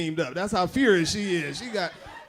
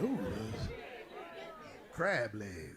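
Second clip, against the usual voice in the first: lower, softer, longing murmur; hungry old man; 8 semitones lower, 12 dB quieter, much slower than usual. Retold or imitated. Hungry man staring at food, low longing murmur. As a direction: moan it like a starving man eyeing food. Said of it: "He like".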